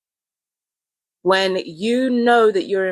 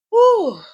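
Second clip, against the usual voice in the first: loud breathy whew after laughing; real recovery sigh; high exhale of relief; 5 dB louder than usual. Real, not acted. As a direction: blow out the laughter.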